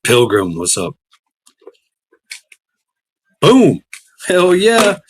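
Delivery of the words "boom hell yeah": loud, high, hyped yell; pumped-up boom; excited shout. Real, not acted. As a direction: shout it out with a big grin. Hyped reaction to drink.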